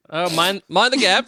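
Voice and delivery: badly faked British with an Australian slant; wobbly British-Australian accent; British slipping into Australian. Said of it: "I'm kinda slipping into Australian".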